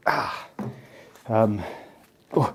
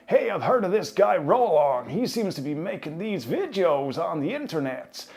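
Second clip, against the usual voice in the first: put-on American accent; 8 semitones higher than usual. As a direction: play a big American exec. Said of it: "that American accent".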